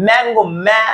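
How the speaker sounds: ম্যাঙ্গো, then just its first syllable ম্যা, starting on a closed-lip "m"; consonant M sound. Shown as a demonstration. Teacher shows students how M sounds in mango, ম্যা split off from ম্যাঙ্গো. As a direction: isolate the "myaa" that opens ম্যাঙ্গো. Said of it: "এখানে এম এর উচ্চারণ কি হচ্ছে".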